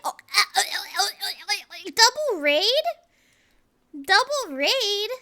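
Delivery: loud, high flustered disbelief; stunned surprise. Real, not acted. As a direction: shriek in shock at the double raid. Shocked by double raid.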